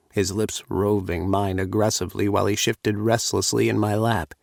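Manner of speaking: usual speaking voice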